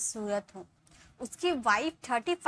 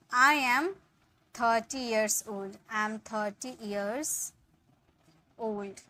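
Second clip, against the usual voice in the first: slow, clear dictation; slow model sentence; much slower than usual. Shown as a demonstration. Teacher dictating the translation slowly. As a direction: stretch it for students to follow. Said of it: "इसके लिए आप बोलोगे".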